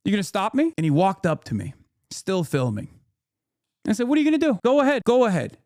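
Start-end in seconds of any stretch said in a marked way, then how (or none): 0.0-0.7 pitched-up daring retort; retold confrontational challenge. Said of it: "cortisol spike". 4.1-5.6 high, rapid-fire dare; retold goading. Retelling his dare to a heckler.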